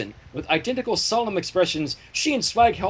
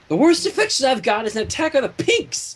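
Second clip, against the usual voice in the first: irritated sputter; 7 semitones higher than usual. A narrator's reading. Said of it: "Twilight sputtered".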